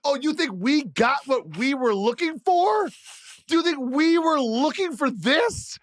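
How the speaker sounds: mock-indignant, incredulous questions as a Mavs fan; sarcastic Mavs fan. Mavs fan answers the team owner, sarcastic and incredulous. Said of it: "If you're a Mavs fan and you read this, you're like".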